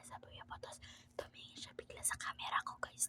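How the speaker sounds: very soft, hushed whisper. Real, not acted. Prankster telling viewers about the sudden glance, whispering. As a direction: confide it quietly to the camera. Secret update during the prank.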